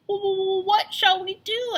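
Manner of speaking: high, slow stuttering question; scared pig asking for a plan; stammering fear. A narrator's reading. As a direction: stammer it out in panic. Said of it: "asked Boris".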